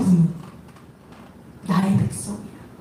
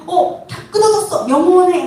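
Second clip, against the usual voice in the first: high, loud exclamation of dismay; playful, pitched-up panic; mock alarm; 7 semitones higher, 4 dB louder than usual. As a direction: act out a startled overreaction. Acts out mistaken alarmed reaction.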